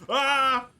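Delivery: sudden high yelp of fright; startled scream. Real, not acted. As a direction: scream from a jump scare. Jump scare in game.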